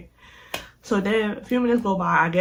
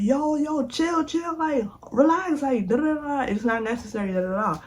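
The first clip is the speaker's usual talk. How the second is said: urgent calming; 8 semitones higher than usual. Retold or imitated. Friends trying to break up fight.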